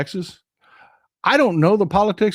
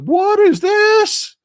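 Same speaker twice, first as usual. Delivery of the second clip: much higher, slow, stretched-out disbelief; baffled; 4 dB louder, much slower than usual. Retold or imitated. Spotting costumed baseball players on a restaurant screen, stunned high disbelief. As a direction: squeak it out like you can't believe your eyes. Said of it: "I was like".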